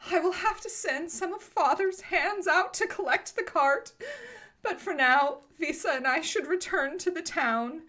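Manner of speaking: put-on high-pitched, put-upon young lady; overdramatic girl. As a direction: play the wronged girl in a high voice. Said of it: "takes a big, deep, overdramatic sigh".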